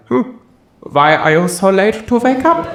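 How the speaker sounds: high, mocking voice of a judging object; judging alarm clock voice. The judging thing mocks the late riser, high and snide. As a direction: needle me for sleeping in, high and snide. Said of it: "being like".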